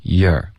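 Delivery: "year" said as a short weak syllable for "you're"; weak reading. Shown as a demonstration. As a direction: say "year" lightly, unstressed, as the reduced "you're". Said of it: "我们就弱读成year". (把"year"轻读成弱读的you're)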